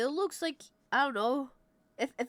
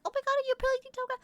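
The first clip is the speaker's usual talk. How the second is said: much higher, fast, breathless fan gush; squeaky fan impression; 4 dB louder, much faster than usual. Fan meeting the streamer in Amsterdam, high fast gushing. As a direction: play a starstruck fan blurting it out. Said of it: "That's how you sound twenty-four seven?".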